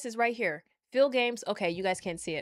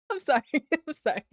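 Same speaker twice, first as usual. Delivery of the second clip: fast, giggly sorry through laughter; can't stop laughing while apologising; sorry bubbling out between laughs; laughing apology; 4 semitones higher, 4 dB louder than usual. Real, not acted.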